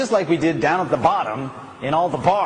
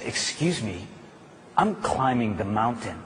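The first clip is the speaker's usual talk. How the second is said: softer, calm inner voice; quiet resolve; 4 semitones lower, 5 dB quieter than usual. Acted. Old lady in her mind tells distractions she is focused, quiet resolve. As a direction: say it quietly to yourself, settled. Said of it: "In her mind, she said".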